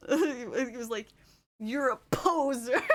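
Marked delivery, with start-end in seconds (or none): 1.6-2.8 laughing, drawn-out imitation of an offended customer; mock betrayed. Imitating a customer upset she wasn't French, mock betrayed. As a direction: accuse slowly, giggling through it. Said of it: "he was totally betrayed".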